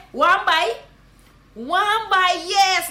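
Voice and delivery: high, loud Guyanese Creole hail; Guyanese greeting. Shown as a demonstration. Guyanese teacher hailing a boy friend. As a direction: call out to a mate, Guyanese-style. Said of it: "That's how you gonna say".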